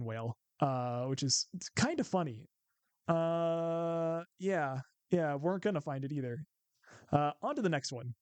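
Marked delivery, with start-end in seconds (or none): none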